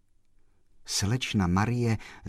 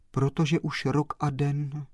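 plain reading voice

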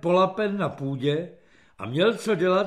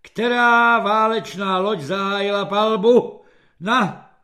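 raised, loud exam question; impatient prompting; 4 semitones higher, 6 dB louder than usual. A narrator's reading. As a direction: press a floundering pupil for the answer. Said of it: "pobízel ho netrpělivý generál". (netrpělivé zkoušení)

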